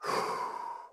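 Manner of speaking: long audible exhale, slowing down; slow demo breath. Shown as a demonstration. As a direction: breathe out slowly to show the calm. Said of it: "when you take a breath".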